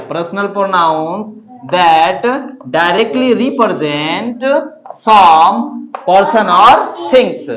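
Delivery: "that", pause, "directly represent", pause, "some", pause, "person or things" stretched; phrased with pauses. Shown as a demonstration. Teacher leading the class through the chunked definition. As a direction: break it into slow chunks. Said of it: "फिर से बोलिएगा".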